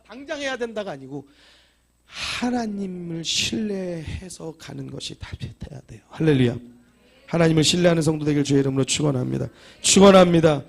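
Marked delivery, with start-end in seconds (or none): 6.1-10.6 loud ringing blessing, rising to a cry; full-force preaching. Acted. Pastor blessing the congregation, loud preaching. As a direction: proclaim it over the whole church.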